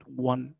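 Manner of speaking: "won" pronounced exactly like the number one; sounds like "one". Shown as a demonstration. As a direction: say "won" as "wun". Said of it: "بيتنطق بالظبط زي رقم واحد".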